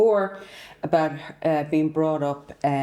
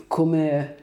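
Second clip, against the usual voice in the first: normal talking voice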